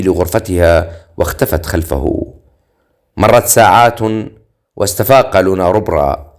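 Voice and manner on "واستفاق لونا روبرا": ordinary delivery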